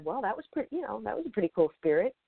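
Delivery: high, fast, giddy wrap-up; delighted. Retold or imitated. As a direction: bubble over at how cool it was. Delighted after spirit session.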